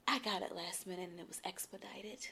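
mock hushed whisper, breathy and low; retold whisper. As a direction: whisper it like a half-secret brush-off. Retold whispered passport excuse.

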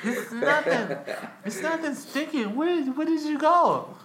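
high-pitched imitation of a confused girlfriend. Man mimicking his girlfriend, high and puzzled. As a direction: play her confused and clueless, pitch way up. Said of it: "I'm like".

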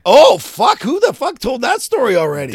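high, loud burst of disbelief; real startled outburst; loud outraged surprise. Real, not acted. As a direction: blurt it out, caught out. Caught out by embarrassing story.

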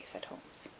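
plain reading voice

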